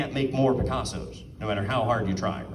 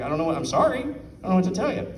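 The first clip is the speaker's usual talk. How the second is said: helpless apology; 6 semitones higher than usual. Retold or imitated. Retold apology to upset customer.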